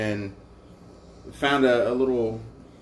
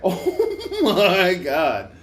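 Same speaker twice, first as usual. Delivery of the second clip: slow oh my God through laughter; slow laughing disbelief; 6 semitones higher, 4 dB louder, much slower than usual. Retold or imitated. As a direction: drag it out while laughing. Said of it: "I was like".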